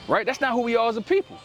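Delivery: ordinary delivery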